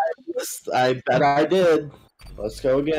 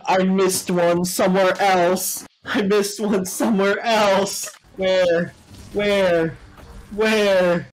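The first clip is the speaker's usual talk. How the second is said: frantic shouting; 9 semitones higher than usual. Real, not acted. Frantic search in game.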